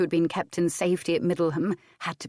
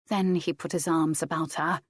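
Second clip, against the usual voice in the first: plain reading voice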